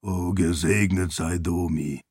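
low, soft exclaimed blessing; Ashe's relieved call. A narrator's reading. The seon Ashe reaches Sarene at last, low and relieved. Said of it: "rief das Seon".

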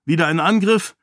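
concerned question. A narrator's reading. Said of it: "wollte Raoden besorgt wissen". (besorgte Nachfrage)

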